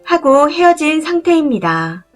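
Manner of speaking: ordinary delivery, nothing marked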